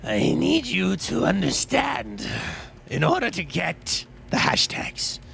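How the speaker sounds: slow, theatrical monster explaining its craft; hammed-up evil creature lecturing, long pauses. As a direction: draw every phrase out with swooping pitch. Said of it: "I discovered that voice the other day".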